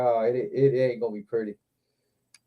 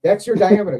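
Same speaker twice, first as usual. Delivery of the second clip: ordinary delivery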